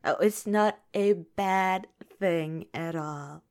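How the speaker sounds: ordinary talk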